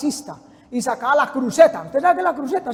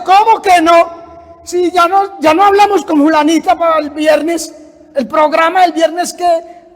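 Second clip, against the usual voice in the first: louder, higher goading devil; tempting devil; 4 semitones higher, 7 dB louder than usual. Acted. Devil luring the man to Friday's plan, loud pushy goading. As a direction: badger him into going out, mock-indignant. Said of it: "el diablo le decía".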